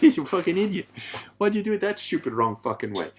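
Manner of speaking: loud, high-pitched blokey scolding; mock berating. Guy to male friend who messed up, mock berating. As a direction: rip into your mate in jest. Guys bluntly berating each other.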